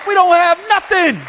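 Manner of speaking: high, loud repeat of "don't"; "don't have nothing" shouted from the pulpit; emphatic shout. Real, not acted.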